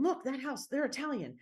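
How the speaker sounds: high-pitched retold discovery; excited pointing out. Telling her husband about a house they drive past, excited.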